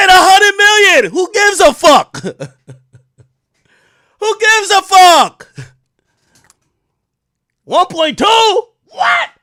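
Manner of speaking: high, loud and slow, laughing in disbelief; amazed shout. Retold or imitated. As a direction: shout it out like the price is unreal. Retelling his amazed reaction.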